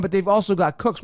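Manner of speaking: ordinary delivery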